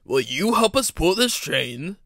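loud, slow, commanding question; booming director. A narrator's reading. Director asking Henry to help with the train, big slow voice. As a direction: ask it big and slowly.

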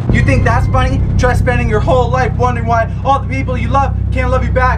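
loud, high spooky ghost voice; dramatic ghost voice. Acted. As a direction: ham it up as the ghost. Dramatic Nancy monologue over music.

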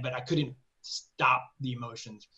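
ordinary talk, nothing marked

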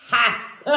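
short burst of laughter, then a high giggle; real laugh. Real, not acted. Reader laughing at the card she drew, real laugh.

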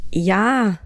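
drawn-out, hesitant yes, then no; mildly flustered, words held and set apart; awkward stammer. A narrator's reading. Narrator answers the stranger unsure of herself, awkward mild stammer. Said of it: "stammelte ich unbeholfen".

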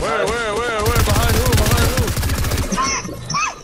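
frantic, loud and high; panicked shouting. Real, not acted. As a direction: yell it in panic. Panicked call in fight.

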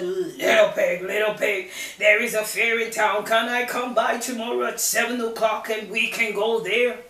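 loud wolf calling; a hint of big bad wolf. Wolf invites.

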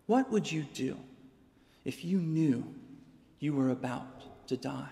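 ordinary talk

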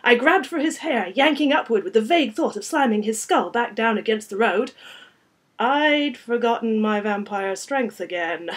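loud, driving action narration; intense, projected storytelling; dramatic story narration. A narrator's reading. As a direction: read the fight at full tilt. Reads fight scene aloud.

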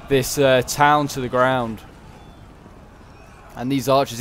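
ordinary talk

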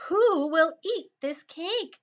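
lightly voiced hen, drawing out the question; little red hen. A narrator's reading. Hen asks who will eat her cake, lightly acted slow question.

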